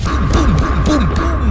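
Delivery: loud, high-pitched imitated booms; vocal suspense sting, booming bursts; tiruan bunyi dentuman. Efek kejutan saat wayang terlihat. (dentuman tegang ditirukan dengan mulut)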